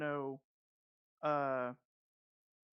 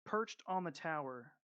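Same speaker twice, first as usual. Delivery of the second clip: game master scene setting; 3 dB quieter than usual. Acted. DM opening the first session for the players, ominous scene narration. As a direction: draw the players into the burning town.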